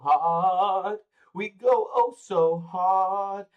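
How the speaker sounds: hype drawl. Acted. Hyping up the stream opener.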